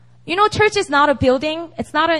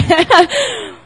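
breathy high laugh after a fright; real sheepish giggle; embarrassed laugh; 8 semitones higher, 5 dB louder than usual. Real, not acted. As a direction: laugh it off, higher. Laughs after being startled.

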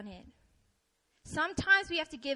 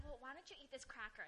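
very soft, quick offer; gentle suggestion; 21 dB quieter, faster than usual. Retold or imitated. Friend offering a frustrated person food, soft suggestion. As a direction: slip it in quietly, as a retold friend.

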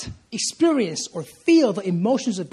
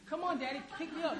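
put-on high, soft whining little boy; toddler's plea; 7 semitones higher, 16 dB quieter, faster than usual. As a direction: plead like a small child wanting up. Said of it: "I'm like".

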